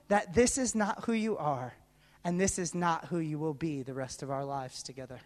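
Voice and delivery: very low, hushed loving assurance; quiet, earnest promise; solemn reassurance. Retold or imitated. Retells partner's grave, loving assurance.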